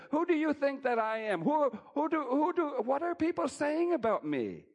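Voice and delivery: voiced Jesus asking, high and loud; high, loud Jesus question. Preacher booming Jesus' question to followers. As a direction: pitch it up and call it out as Jesus.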